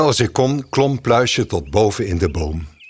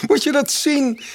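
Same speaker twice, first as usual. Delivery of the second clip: higher, bright mockingbird calling out; cheerful bird voice. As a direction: call out proudly like a clever bird. Mockingbird character voice. (vrolijke spotvogelstem, hoger)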